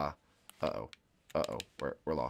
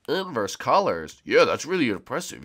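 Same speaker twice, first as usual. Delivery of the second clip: ordinary talk, nothing marked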